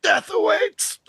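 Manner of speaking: very high, shouted mock-menacing villain voice; hammed-up villain cry. Joking as the Phantasm trailing smoke, high hammed-up shriek.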